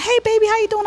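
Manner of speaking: high, loud, delighted hello; excited retold greeting. Sister retelling her surprise greeting to brother, excited. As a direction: greet a loved one in an excited high pitch.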